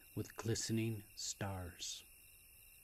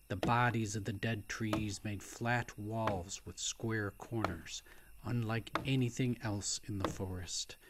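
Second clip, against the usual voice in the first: plain reading voice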